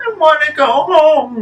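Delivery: put-on high, tearful childlike whimper; high weepy plea. A narrator's reading. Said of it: "whimpers Sandy".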